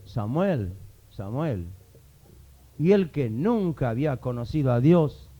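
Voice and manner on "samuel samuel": low, soft call of Samuel's name; a hint of a hushed divine call from afar; God calling the sleeping boy Samuel, low and soft. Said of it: "escucha una voz".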